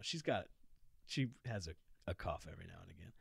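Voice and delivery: ordinary delivery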